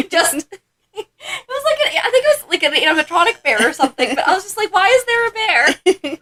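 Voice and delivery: high, laughing disbelief; baffled question pitched high through giggles; laughing while talking. Real, not acted. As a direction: struggle through it, cracking up. Cracking up recalling the bear.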